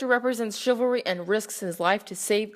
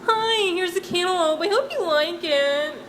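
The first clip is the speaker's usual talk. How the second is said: cheery innkeeper; 8 semitones higher than usual. Acted. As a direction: greet him like an overeager innkeeper. Owner gives cantaloupe.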